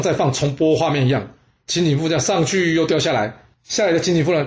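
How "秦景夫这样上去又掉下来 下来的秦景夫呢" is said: plain reading voice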